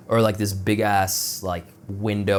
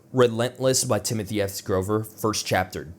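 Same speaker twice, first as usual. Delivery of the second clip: ordinary delivery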